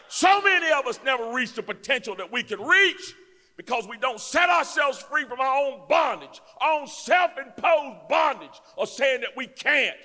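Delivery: loud, rhythmic pulpit build; preaching. Acted. Preacher rallies the congregation against self-doubt, shouting. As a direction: hammer each "somebody" like a sermon.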